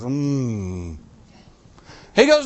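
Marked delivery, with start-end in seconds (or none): 0.0-0.9 low, soft, doubtful hmm of a crowd; a whole church groaning hmm; reluctant hmm. Retold or imitated. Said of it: "And everybody goes".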